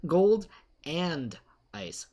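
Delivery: "Gold and ice." slow, each word apart, "Gold" stressed; stressed and slowed correction. Shown as a demonstration. Streamer correcting himself, slow and deliberate. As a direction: spell out "Gold and ice" slowly, no "golden". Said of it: "not golden ice".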